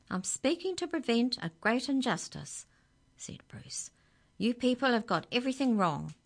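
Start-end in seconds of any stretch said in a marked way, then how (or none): 0.1-2.7 high-voiced little bird in protest; high, impassioned character voice; protesting budgie. A narrator's reading. Budgie protests against the judgment. 4.4-6.2 high, loud, fast bird voice; quick, indignant little budgie; scolding budgie. A narrator's reading.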